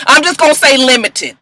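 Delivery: normal talking voice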